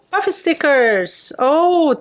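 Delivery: light up at the stickers; thrilled little oh; excited oh. Real, not acted. Opening the puffy stickers.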